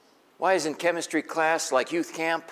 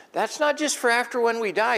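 ordinary talk, nothing marked

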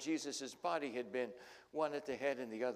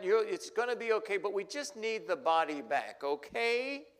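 pleading Mary; 8 semitones higher, 8 dB louder than usual. Retold or imitated. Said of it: "and says".

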